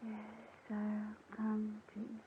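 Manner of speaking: very faint, slow drugged murmur; falling asleep. Acted. Rose obeys Helen while drugged, falling asleep. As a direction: let the words fade out into sleep. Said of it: "She's asleep".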